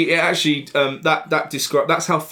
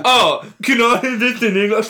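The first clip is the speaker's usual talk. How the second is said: higher, whiny mock of a film character; mocking cliche line, pitched up; 6 semitones higher, 3 dB louder than usual. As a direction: sneer it like a dim crewman. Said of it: "I get so sick of hearing in films".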